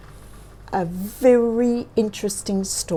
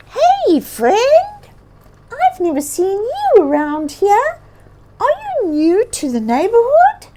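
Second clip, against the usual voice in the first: high, bright-eyed bird voice, louder; curious little bird; 14 semitones higher, 6 dB louder than usual. A narrator's reading. Bird greeting a lost bear, high and curious. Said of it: "she asked curiously".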